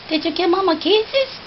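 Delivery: fast, high sing-song question. Real, not acted. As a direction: ask the bird fast and high. Excited baby talk.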